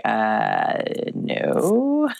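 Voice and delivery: hesitate, then lift a long, disbelieving no; incredulous no. Retold or imitated. Hypnotherapist imagining her younger self's answer, incredulous. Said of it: "I would have been like".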